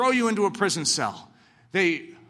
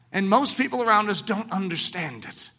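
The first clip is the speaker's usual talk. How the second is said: normal talking voice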